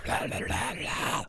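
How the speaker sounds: guttural undead NPC noises; rough, snarling undead babble; undead monster growl. Acted. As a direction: growl it like a zombie game character. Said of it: "all the, like, undead N P Cs are like".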